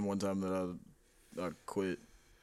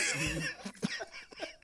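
high breathy laugh; 3 dB quieter than usual. Real, not acted. Laughing at retold quote.

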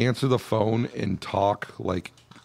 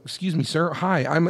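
high-pitched polite caller voice; polite telemarketer; 7 semitones higher than usual. Acted. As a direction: imitate a caller meekly trying to cut in.

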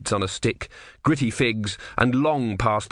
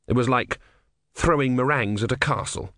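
plain reading voice

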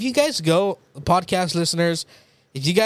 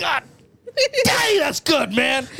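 mock delighted exclaim; 8 semitones higher, much slower than usual. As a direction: ham up a satisfied bite. Imitating friend enjoying pizza.

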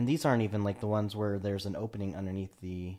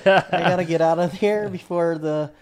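mock scared self; 10 semitones higher, 12 dB louder than usual. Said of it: "So you're just like".

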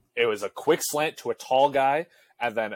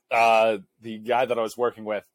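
ordinary talk

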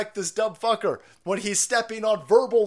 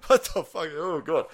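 normal talking voice

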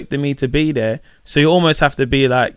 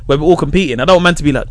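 ordinary talk

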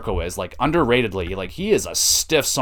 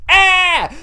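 sharp high yelp of pain; imitated cry of pain; pained yell; 6 dB louder than usual. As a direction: yelp like you just took a punch. Wrestler yelling when punched.

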